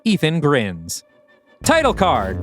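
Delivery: ordinary talk, nothing marked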